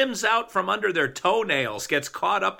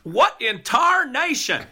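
higher, slow hint of a cowboy drawl; cowboy exclamation; 6 semitones higher, slower than usual. Host slips in a cowboy phrase to tease his cohost, lightly hammed-up. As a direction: toss off a lightly hammed-up Old West line. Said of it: "See what I did again there?".

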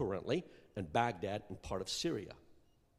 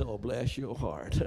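slow Southern drawl on "Oh, bless your heart"; slow, laughing Southern "bless your heart"; slower than usual. Retold or imitated. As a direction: say it like a sweet Southern put-down with a laugh. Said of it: "take it the Southern way".

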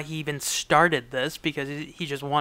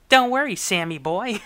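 higher-pitched mock Cleveland accent; 5 semitones higher, 4 dB louder than usual. Woman to Sam as she should sound, Cleveland accent. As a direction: reassure him in put-on Cleveland speech. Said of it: "have that Cleveland accent".

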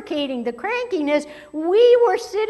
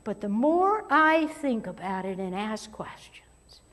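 hushed emphatic whisper; 4 semitones lower, 5 dB quieter than usual. Real, not acted. Preacher whispers the key point to the congregation. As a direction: drop to a whisper to drive it home.

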